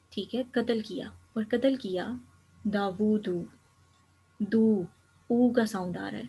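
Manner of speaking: usual speaking voice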